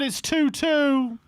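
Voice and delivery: high, drawn-out score announcement; slow it right down and pitch it up; excited score call. Real, not acted. Commentator telling viewers Haaland has scored, excited.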